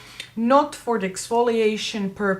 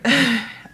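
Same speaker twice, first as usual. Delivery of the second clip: short laugh; 3 semitones higher, 3 dB louder than usual. Real, not acted. Embarrassed after losing her thread.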